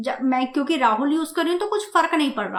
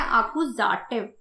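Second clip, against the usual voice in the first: slow teaching word; 4 semitones lower, 4 dB quieter, much slower than usual. Shown as a demonstration. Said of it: "क्या करने जा रहे हैं?".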